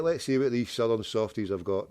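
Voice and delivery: ordinary talk, nothing marked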